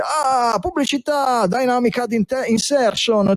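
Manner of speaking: high, fast, eager sales pitch; pushy ad agency salesman. Retold or imitated. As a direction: gush like an overexcited ad salesman. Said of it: "l'agenzia arriva subito e dice".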